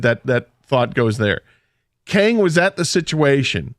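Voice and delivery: ordinary talk, nothing marked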